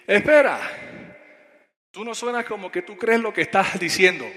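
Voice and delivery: put-on loud, high, indignant young evangelist; bien bravo. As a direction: cut in fiercely, acting out a fired-up youngster. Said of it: "bien bravo". (reclamo bravo imitado, fuerte y agudo)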